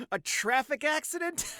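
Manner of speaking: high, incredulous villain mockery between laughs; mocking amusement. Skullman mocking the headline, gleefully incredulous. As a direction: scoff it high through laughter. Villain mocks the newspaper story.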